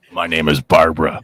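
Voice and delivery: very low, gruff woman's voice; man doing a woman's voice. Acted. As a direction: play a burly guy saying a woman's name. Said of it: "in his girl voice".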